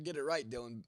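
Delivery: normal talking voice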